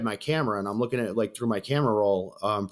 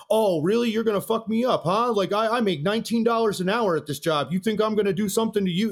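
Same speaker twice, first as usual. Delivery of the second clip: higher-pitched, sarcastic comeback; mocking challenge; 8 semitones higher than usual. Bouncer answering a customer's threat, mocking disbelief. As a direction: imitate someone scoffing at a threat.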